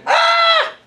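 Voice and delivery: sudden high wail like a newborn; short high baby wail; newborn cry. Shown as a demonstration. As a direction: cry out like a baby taking its first breath. Preacher imitates newborn's first cry.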